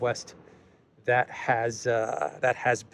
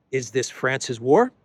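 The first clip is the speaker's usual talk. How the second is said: normal talking voice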